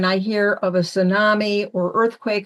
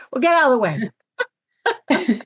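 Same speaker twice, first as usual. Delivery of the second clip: truck driver; 5 semitones higher, faster than usual. Retold or imitated. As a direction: boss her out of the way, gruff but kind. Truck driver voiced in retelling.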